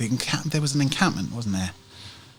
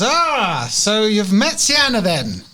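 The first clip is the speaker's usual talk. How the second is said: shopkeeper woman, wryly knowing; 10 semitones higher, 5 dB louder than usual. Acted. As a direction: play a woman reacting to familiar trouble, pitched up. Game master plays the shopkeeper woman.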